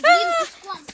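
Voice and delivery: loud urgent yell; urgent shout. Real, not acted. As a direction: yell it in a hurry. Urging to put out fire.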